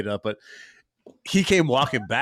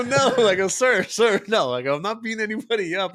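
mock protest; 8 semitones higher than usual. Builder answering the senior citizen, mock protest. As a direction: protest as if wrongly accused. Acts out his denial to neighbor.

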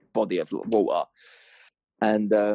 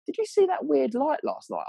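high, excited quoted neighbour; mate imitation. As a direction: pitch the neighbour's question up high. Neighbour quoted, voice much higher.

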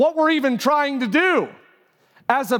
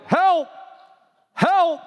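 mock yell; 7 semitones higher than usual. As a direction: yell for help as the trapped friend in a joke. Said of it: "The other friend is yelling".

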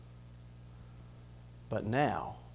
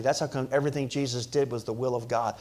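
ordinary talk, nothing marked

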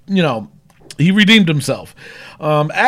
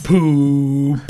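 ordinary talk

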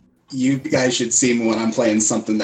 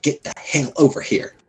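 higher, insistent beckoning; very obvious summons; 6 semitones higher than usual. Acted. Waving Yvette over to the table, very obvious. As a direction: make the summons obvious, pitched up. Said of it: "I'm gonna be very obvious about".

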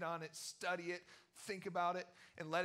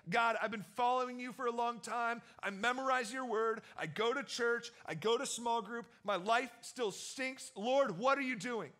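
higher, louder aggrieved complaint to God; frustrated prayer; 6 semitones higher, 7 dB louder than usual. Retold or imitated. Preacher voicing his own complaint to God, higher and louder. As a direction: list your good deeds, then demand. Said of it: "I've said".

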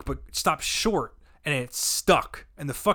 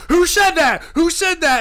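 high, loud demanding question; imitated crowd outcry; 11 semitones higher, 8 dB louder than usual. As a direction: call out as a startled crowd. Crowd looks for the culprit.

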